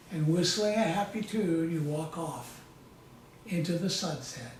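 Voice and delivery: calm, measured hypnotic storytelling voice; guided hypnosis. Acted. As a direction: lead them gently through a visualization, pausing often. Leads a guided visualization.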